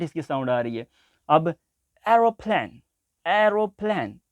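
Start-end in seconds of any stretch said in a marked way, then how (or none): 2.1-4.2 teacher modelling एरोप्लेन for students; careful word demo. Shown as a demonstration. Teacher shows the class how to say it, careful demo. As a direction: say the word एरोप्लेन slowly and clearly, stretched for learners. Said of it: "अब वहाँ पे और different sound".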